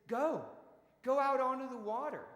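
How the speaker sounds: loud, high sending-out order; acted command. Jesus sending disciples out to sea, acted loud command.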